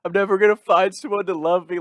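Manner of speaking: high, loud, over-the-top wail; mock crying. Guy retelling his morning-after meltdown, mock crying. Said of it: "I'm crying like".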